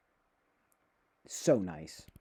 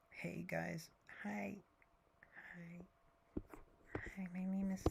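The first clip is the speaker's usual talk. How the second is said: breathy, higher whisper; whispered intro; 6 semitones higher, 12 dB quieter than usual. Pretend. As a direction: whisper the greeting, like a shy new character. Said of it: "I need to come up with different, like, characters".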